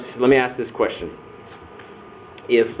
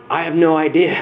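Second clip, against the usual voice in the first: laughing; 8 semitones higher, 5 dB louder than usual. Retold or imitated. Friend laughing about fostering.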